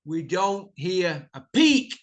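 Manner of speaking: normal talking voice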